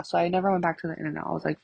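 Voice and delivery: ordinary talk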